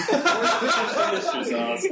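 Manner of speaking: high, slow laughing "Worcestershire"; laughing correction. Real, not acted. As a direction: laugh it out slowly.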